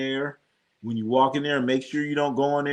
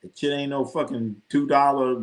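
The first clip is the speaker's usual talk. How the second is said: usual speaking voice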